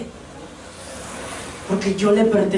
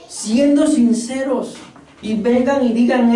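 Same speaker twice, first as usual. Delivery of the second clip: ordinary delivery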